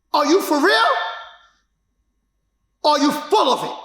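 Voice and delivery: rising, forceful challenge to himself; mock yell. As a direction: push yourself hard, calling out your own doubts. Challenging himself after the diagnosis.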